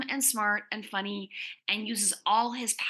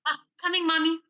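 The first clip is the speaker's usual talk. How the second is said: higher, louder call back to mom; 7 semitones higher, 6 dB louder, faster than usual. Acted. Teen girl answering her mom's dinner call, higher and louder. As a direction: sing out an answer from the other room.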